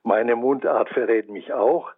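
usual speaking voice

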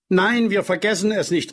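put-on high, eager childish promise; children calling out. A narrator's reading. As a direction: assure him brightly like kids. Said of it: "riefen die Kinder aus". (eifrig rufende, hohe Kinderstimme)

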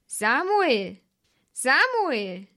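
long, sung-out distant call of the name; God calling. A narrator's reading. God calls the sleeping boy by name, drawn-out distant call.

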